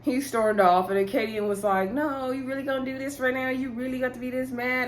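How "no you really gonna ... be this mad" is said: higher, softer mock protest; light, raised voice of mock disbelief; retold disbelief. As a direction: imitate her incredulous pleading. Retells Katie's protest as he leaves.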